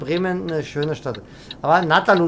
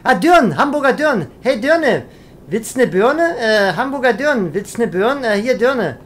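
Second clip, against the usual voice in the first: raised, loud mock catcall; calling out; 4 semitones higher, 4 dB louder than usual. Trucker hailing a Hamburg girl, calling out. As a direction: holler at a passer-by in mock dialect.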